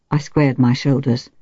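ordinary delivery

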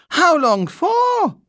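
slow, loud, high-pitched demand; aghast housekeeper. A narrator's reading. Housekeeper asking how long the bear stays, aghast. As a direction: wail the question high and drawn out. Housekeeper raises arms in alarm.

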